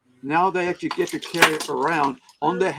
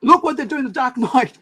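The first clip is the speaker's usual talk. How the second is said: higher, louder excited point-out; excited exclamation; 9 semitones higher, 6 dB louder than usual. Retold or imitated. Friend points out the cut-up comics, excited and higher. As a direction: show your friend something outrageous. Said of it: "And Shane goes".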